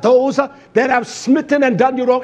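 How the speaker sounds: ordinary delivery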